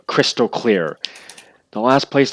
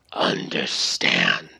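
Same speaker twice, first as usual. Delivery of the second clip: much lower, slow, voiceless rumble; hushed creature reply; 4 dB quieter, much slower than usual. Acted. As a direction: drag the word out low. Half dragon's low reply.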